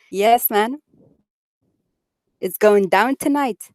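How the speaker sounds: usual speaking voice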